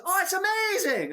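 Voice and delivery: high gushing fake praise; eager plant in crowd. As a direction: gush like a paid shill. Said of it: "and he goes".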